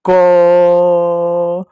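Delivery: long, level, sustained "क"; drawn-out क. Shown as a demonstration. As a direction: hold the vowel of "क" for a long beat. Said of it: "मैं एक बार बोलता हूँ".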